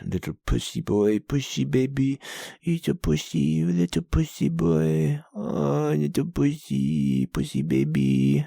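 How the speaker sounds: sing-song teasing; baby-talk taunt at a friend; taunting a friend for going to bed early, sing-song mockery; mocking taunt. Mocking friend who sleeps early.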